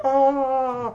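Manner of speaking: high, drawn-out collective aww; sympathetic crowd. Retold or imitated. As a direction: imitate a whole audience reacting. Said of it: "the whole crowd was like".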